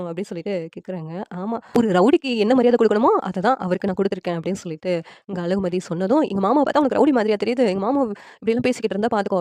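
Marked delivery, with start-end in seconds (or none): 1.8-4.4 heated retort. A narrator's reading. Wife answers back about her husband's uncle, heated. 6.3-9.4 loud, angry warning. A narrator's reading. Husband defends his uncle against his wife's insult, angry. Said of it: "Suresh ஒரு பக்கம் கோவமா பேசுறாங்க".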